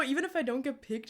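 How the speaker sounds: ordinary delivery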